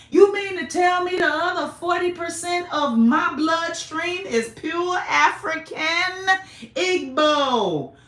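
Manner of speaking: loud, high cries of what; can't believe the DNA results; shocked disbelief. Real, not acted.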